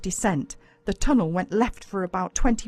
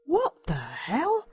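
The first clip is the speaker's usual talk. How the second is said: surprised; 7 semitones higher, much slower than usual. A narrator's reading. Boy finds secret slit.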